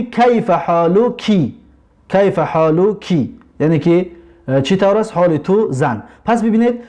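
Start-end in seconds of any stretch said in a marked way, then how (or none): 0.0-3.3 کیف حالکی said very slowly, twice; teacher repeats کیف حالکی slowly for the class; slow Arabic demo. Shown as a demonstration. Teacher models the greeting to a woman, slow and clear. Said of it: "اینجا که زن هست".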